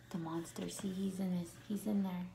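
hushed bedtime whisper. Acted. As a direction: whisper so the monster won't hear. Bedtime, monster hiding.

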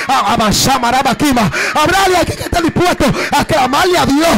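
loud, tearful preaching cry; full-force preaching. Acted. Pastor urging the congregation, loud tearful preaching. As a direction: preach it out to the whole church.

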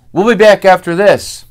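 lightly hammed announcer going to break. Announcer cuts to commercial, mock broadcast sign-off. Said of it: "you hear them say".

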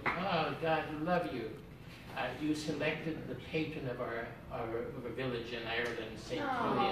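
a light Irish brogue, mother's blessing; lightly voiced Irish mother's brogue. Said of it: "in her wonderful Irish brogue".

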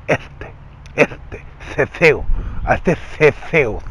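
slow lisped "este ceceo"; ceceo escupido. Shown as a demonstration. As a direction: say "ceceo" with spitty th sounds. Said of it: "Como que escupe o ceceo". ("ceceo" lento y ceceado, como que escupe)

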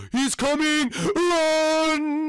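shrieked high fake alarm; fake panic. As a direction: yell it like a false alarm. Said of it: "No, just joking".

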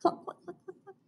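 high cluck fading into tiny clucks; chicken cluck. Shown as a demonstration. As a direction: cluck like a hen.

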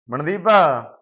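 loud call with a falling end. Acted. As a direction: call her name across the house. Calling wife to come here. (ਘਰ ਵਿੱਚ ਉੱਚੀ ਹਾਕ ਮਾਰਨਾ)

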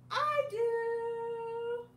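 sing-song volunteer; eager Pig. Acted. Pig answering in story.

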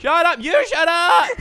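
loud, high angry yell; shouting back. Real, not acted. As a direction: shout them down, furious.

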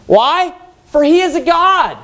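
mock their god; mocking taunt. Elijah jeering at Baal's prophets, high mock sarcasm. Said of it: "He says to him".

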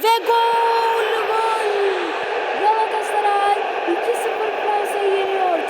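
loud high goal shout; shouting goal at the screen; goal celebration. Real, not acted. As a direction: yell it like a commentator celebrating. Scoring a goal in game.

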